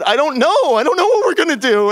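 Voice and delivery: high, loud, fast mock panic; panicked. Narrator panicking back at Dragan, mock frantic. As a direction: blurt it out in a hammed-up frenzy.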